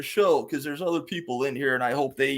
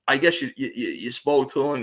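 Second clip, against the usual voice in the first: usual speaking voice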